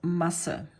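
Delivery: short first vowel in Masse. Shown as a demonstration. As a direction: say Masse with a quick, short A before the s sound. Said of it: "pronounced rather short, shortly".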